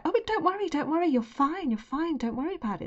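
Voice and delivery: high, soothing reassurance; sweet reassurance. Retold or imitated. Interviewers soothing the panicking candidate, high and sweet. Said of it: "they were so sweet about it".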